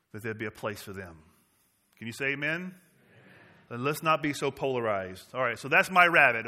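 usual speaking voice

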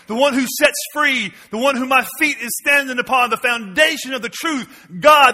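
loud, high fiery sermon cry; full-force preaching. Acted. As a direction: preach it out to the back row.

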